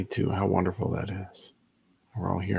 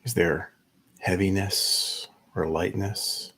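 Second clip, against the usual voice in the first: unhurried meditation guide, long pauses between phrases; measured, spacious guiding voice; guided meditation. Acted. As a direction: ease listeners into stillness, leaving space after each instruction.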